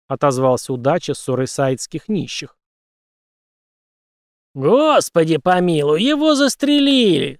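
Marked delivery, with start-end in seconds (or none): 4.5-7.4 slow, high, loud cry; shout. Acted. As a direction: howl it out in alarm. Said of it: "закричал Харибин". (громкий крик)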